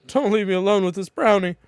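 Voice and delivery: high, loud hammed-up plea; mock pleading. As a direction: beg as if in comic desperation. Reads a pleading line in game.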